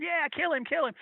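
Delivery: high-pitched imitated crowd cheer; excited mock mob cry; egging him on. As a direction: urge him on like a bloodthirsty fan. Crowd eggs on the wrestler.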